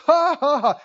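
loud put-on "ha, ha, ha"; mock forced laugh. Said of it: "He said".

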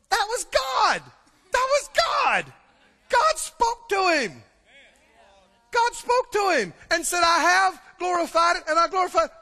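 high, loud, stretched shouts; excited believers. Acted. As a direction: shout it out like an amazed witness. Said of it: "And others are going".

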